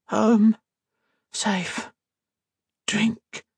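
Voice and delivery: put-on high, halting one-word child voice; feral sick girl. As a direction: drop each word like a dazed child. Feral girl answering in fragments.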